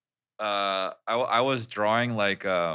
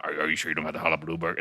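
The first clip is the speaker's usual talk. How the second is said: lower, quick Texan voice; put-on Texan accent; 5 semitones lower, 4 dB quieter, much faster than usual. Doubting drive-through staff, lower Texan accent. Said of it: "I'm from, actually from Texas".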